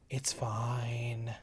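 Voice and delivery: soft unvoiced whisper. Real, not acted. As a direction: whisper it to yourself. Repeat whispered.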